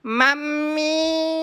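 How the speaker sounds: long drawn-out call to mother; slow wailing call; calling out for mummy. Acted. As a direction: stretch it out, calling mum. Child calls out for mother.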